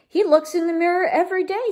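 put-on high, quick storybook kid voices; little sisters. A narrator's reading. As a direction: pipe up fast and high as tattling sisters. Sisters telling on their brother.

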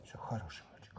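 soft whispered praise; whispering. Real, not acted. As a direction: whisper it gently to a pet.

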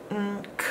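"Mm, k" very soft; soft sounded out. Shown as a demonstration. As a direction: whisper the end sounds. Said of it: "There's our diphthong".

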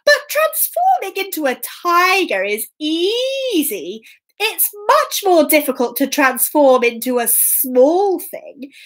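high cat voice easing into mock pondering; sly storybook cat pretending to think; crafty Puss. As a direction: dangle the challenge, drawing out key words. Puss craftily challenges the ogre.

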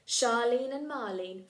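plain reading voice

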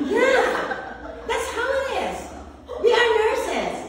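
high-pitched laughing talk. Real, not acted. Nurse laughs with trainees about her naked habit, high laughing voice. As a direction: speak through real laughter, up high.

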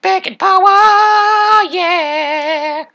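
very high, loud, slow cheer; drawn-out victory cheer. Acted. Gamer powered up by bacon, victory cheer. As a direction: belt a goofy battle cry.

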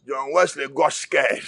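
usual speaking voice

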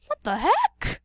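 loud, high squeak of disbelief; squeaky, loud and baffled; high-pitched disbelief. Acted. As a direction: shoot up high on the last word. Same question repeated, escalating.

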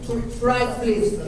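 normal talking voice

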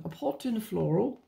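a sudden mock British accent; playful put-on British, saying pot with a round British o. Vlogger slipping into British while planning a sticker layout, mock British accent. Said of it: "I don't know why I got British all of a sudden".

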